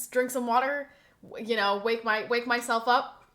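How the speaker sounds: normal talking voice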